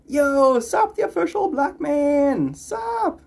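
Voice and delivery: high, loud drawn-out yo; hyped greeting. Real, not acted. Streamer welcoming a chat viewer, high hyped shout-out. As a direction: hype the viewer up as they arrive.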